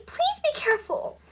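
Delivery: high-pitched plea; high, exasperated appeal; exasperated cry. A narrator's reading. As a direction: plead up in a high voice. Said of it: "cried Maggie".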